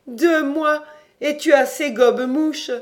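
high, loud, merry jeer; gleeful mockery. A narrator's reading. Jean teases his gullible friend, loudly gleeful. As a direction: crow it in a high, amused voice. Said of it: "dans son insupportable gaieté".

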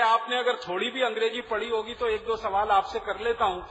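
ordinary delivery

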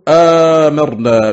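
آمرنا with a long, drawn-out opening aa; lengthened hamza. Shown as a demonstration. Teacher showing Yaqub's reading, آمرنا with lengthened hamza. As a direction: stretch the first vowel of آمرنا. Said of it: "بمد الهمزة".

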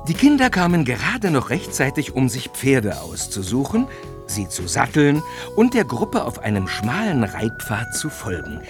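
ordinary talk, nothing marked

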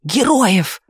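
high, exclaimed near-shout; almost shouting, pitch jumping up. A narrator's reading. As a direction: cry it out in exasperation. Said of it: "почти кричал заведующий труппой".

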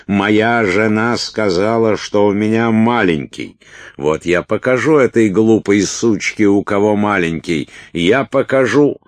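loud, high-pitched indignant tirade; worked-up angry rant. A narrator's reading. Уилт vows to show his wife, angry ranting. Уилт в ярости, продолжает огрызаться.

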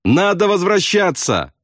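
high, drawn-out shout at moderate volume; slow insistent high call. A narrator's reading. Bombardier urges pilot to turn back, high shout. Shouting over engine roar.

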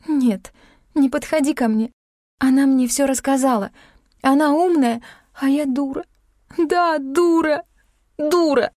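high-pitched, hysterical self-reproach; distraught. A narrator's reading. Heroine trembles as Klim comes near, distraught. Distraught, shaking, pushes him away.

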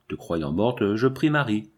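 plain reading voice

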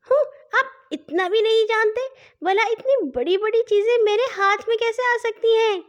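put-on high, childlike little sister; little girl's voice. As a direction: play a cheeky little girl teasing her brother. Narrator voices little sister Nanhi. (ننھی بچی کی باریک، شوخ آواز)

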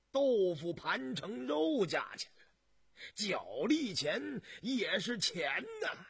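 put-on scornful old man reckoning costs with rising exasperation; drawn-out, emphatic peasant grumbling; scoffing old farmer.

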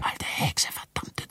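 hissed curse. Acted. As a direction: spit the curse out in a whisper. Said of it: "zischte ich". (gezischter Fluch, leise geflüstert)

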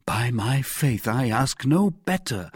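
eager cry. A narrator's reading. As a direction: exclaim your willingness to the official. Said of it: "cried Bonacieux".

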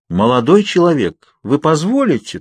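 high, formal nurse's request; ceremonious. A narrator's reading. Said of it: "церемонно проговорила она". (церемонно, высоким голосом)

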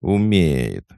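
loud, pleased, drawn-out word; satisfied drawl. A narrator's reading. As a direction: draw it out with satisfaction. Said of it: "удовлетворённо протянула кухарка". (удовлетворённо, протяжно)

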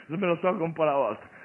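ordinary delivery

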